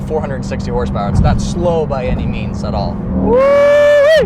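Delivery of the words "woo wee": high, loud woo-wee whoop; yelled woo-wee from a hard launch; excited whoop. Real, not acted. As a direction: let out a thrilled woo-wee.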